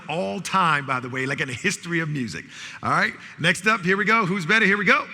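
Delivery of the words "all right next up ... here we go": loud, high call-and-response hype; game announcer. Real, not acted. Host calling out music match-ups to the audience, loud announcer hype. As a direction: fire up the crowd to shout back.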